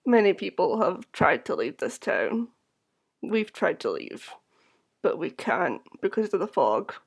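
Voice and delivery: soft, low, lifeless townsperson; eerie hollow voice. Acted. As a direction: drone it softly, drained of life. Eerie tavern keeper speaking.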